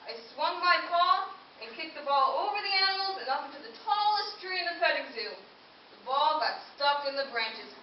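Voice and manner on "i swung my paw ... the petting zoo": ordinary delivery, nothing marked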